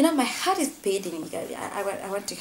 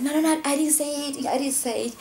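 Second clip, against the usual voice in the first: slightly raised, rapid-fire noes; retold defensive denial; 7 semitones higher than usual. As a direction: lightly imitate a man protesting his innocence. Accused man retold denying it.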